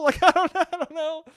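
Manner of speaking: laughing. Real, not acted. Laughing at own awkward line.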